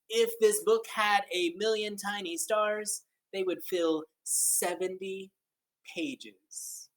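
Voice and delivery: slow, lilting picture-book reading; read-aloud storytelling. Acted. Teacher reading a counting book to children, lilting and slow. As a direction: read it like a wondrous tale to kids.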